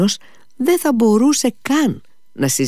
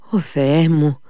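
mild exclamation, pitched up; understated cry of despair; 6 semitones higher, 3 dB louder, slower than usual. A narrator's reading. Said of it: "φώναξε ο Μήτια". (συγκρατημένη αναφώνηση)

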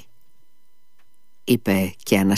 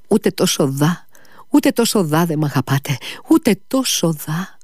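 anguished reproach; 6 semitones higher than usual. Acted. Liza's anguished reproach to Alyosha.

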